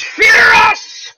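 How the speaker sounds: high, hammed-up shouted threat; mock battle cry. Host voicing a chat member's threat, mock shout. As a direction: bark it like a wrestling heel. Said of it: "Beatles attorney says".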